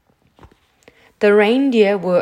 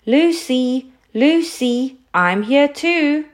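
restrained storyteller's shout, pitched up; calling out; 6 semitones higher, slower than usual. A narrator's reading. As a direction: call out for someone out of sight. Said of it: "and so he shouted".

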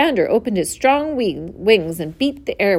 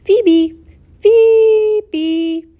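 high, loud phoebe call; bird call; 13 semitones higher, 9 dB louder than usual. A narrator's reading. Narrator voicing the phoebe on an apple bough, high bird call.

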